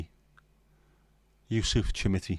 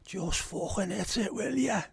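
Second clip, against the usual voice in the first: high, drawn-out Scouse fan cry; exasperated Scouse heckle, rising in pitch; Goodison crowd shout; 11 semitones higher, 4 dB quieter, slower than usual. Acted. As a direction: plead from the stands in a high strained voice. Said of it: "some of the shouts that you get at Goodison".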